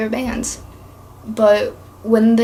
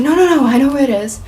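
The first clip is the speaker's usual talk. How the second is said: louder, fast, brushing her off; stubborn insistence; 4 semitones higher, 6 dB louder, faster than usual. Retold or imitated. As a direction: talk over her, sure of himself. Boy stubbornly refuses directions.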